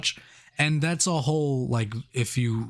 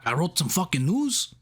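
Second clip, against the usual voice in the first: higher cartoony Mexican accent; mock Mexican accent; 6 semitones higher than usual. Co-host joins in, cartoony Mexican accent. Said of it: "doing this accent".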